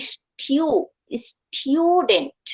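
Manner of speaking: স্টুডেন্ট slowly as "s-tyoo-dent"; T plus "you". Shown as a demonstration. Teacher joining T with you, slow "styu-dent". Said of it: "টি এর সাথে আমি ওইখানে ইউ".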